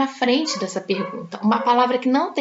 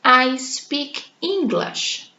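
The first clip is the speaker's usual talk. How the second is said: slow, careful classroom read; teacher modelling a phrase slowly; slow model sentence; much slower than usual. Shown as a demonstration. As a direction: spell it out slowly for the learners. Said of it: "se eu digo que eu falo inglês".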